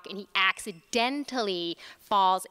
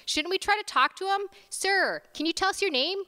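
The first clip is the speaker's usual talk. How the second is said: raised voice; 9 semitones higher than usual. A narrator's reading. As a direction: speak up to a confused patient. Doctor addresses confused patient.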